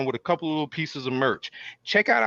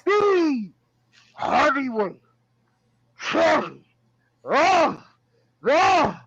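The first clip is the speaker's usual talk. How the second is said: booming ring announcer, drawn out; 10 semitones higher, 3 dB louder than usual. Acted. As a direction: hype the crowd like a wrestling emcee. Wrestling ring announcer intro.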